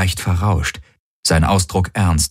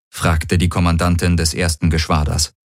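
usual speaking voice

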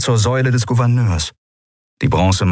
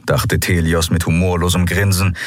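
ordinary delivery, nothing marked

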